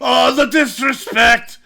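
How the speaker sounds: high, loud, drawn-out mock outrage; Sheamus impression. Mocking how Sheamus was written, hammed-up high outrage. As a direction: wail it in hammed-up indignation. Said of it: "And Sheamus like".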